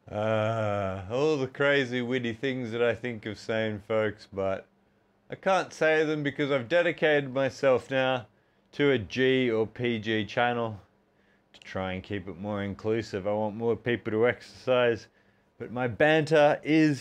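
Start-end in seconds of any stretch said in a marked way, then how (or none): none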